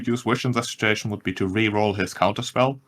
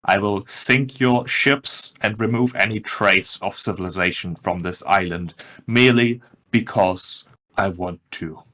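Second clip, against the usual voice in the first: usual speaking voice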